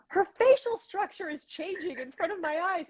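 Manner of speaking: lightly voiced high amazed friend; a hint of a friend's awe; high, wondering imitation; awed friend. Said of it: "she kept saying".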